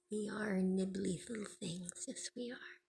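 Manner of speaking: soft, breathy pet talk. Real, not acted. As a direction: whisper sweetly to a pet. Baby talk to bird.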